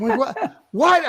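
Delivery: high-pitched, incredulous mock outburst; hammed-up high yelps of disbelief; retold meltdown. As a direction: act out freaking out in disbelief. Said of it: "I melt down on the guy".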